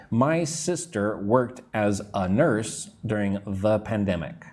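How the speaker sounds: slow, spaced words with "a" as uh, "the" as thuh; teacher's measured read-aloud; slow pronunciation demo. Shown as a demonstration. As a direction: model the sentence slowly for learners. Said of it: "I'm going to say the, not the".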